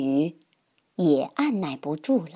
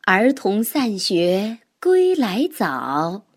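slow, measured poem reading; poem recitation; slower than usual. Acted. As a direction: recite it slowly, line by line. Reads a classical poem thrice.

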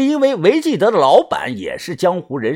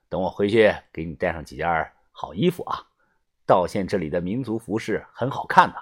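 put-on low older man voice; 8 semitones lower, 6 dB quieter, faster than usual. Low voice for the old boss.

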